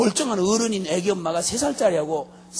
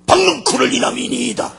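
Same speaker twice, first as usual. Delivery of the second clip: loud, higher mock outburst; petulant temper; 4 semitones higher, 7 dB louder than usual. Jonah answering the Lord about his vine, temper flaring. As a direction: shout the answer back in a huff. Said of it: "박농쿨 때문에 성질 부린대".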